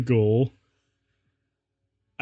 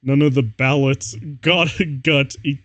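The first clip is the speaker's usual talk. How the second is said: ordinary talk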